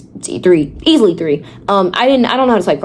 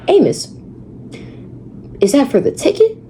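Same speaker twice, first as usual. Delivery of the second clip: curious question; 7 semitones higher than usual. Retold or imitated. Asking Amos, voiced question.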